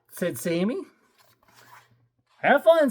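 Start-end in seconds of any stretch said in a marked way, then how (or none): 2.4-2.9 high, loud careless hippo. Acted. The hippo calling after Sammy, not really caring. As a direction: toss it off like a hippo. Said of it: "said the hippo".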